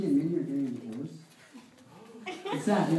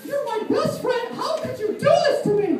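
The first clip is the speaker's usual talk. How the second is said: very high hurt cartoon squeak; betrayed mouse wailing, half laughing; Mickey Mouse voice; 5 dB louder than usual. Mickey accusing his best friend Donald, high squeak.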